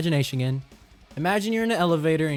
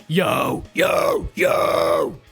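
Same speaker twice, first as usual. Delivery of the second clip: more open, deeper growl on yo; low scream; 4 dB louder, much slower than usual. Shown as a demonstration. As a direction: open wider and roar yo. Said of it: "Open up more".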